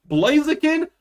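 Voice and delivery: high, rising disbelief at Blaziken; shocked at the name; incredulous. Real, not acted. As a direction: say the name like you can't believe it.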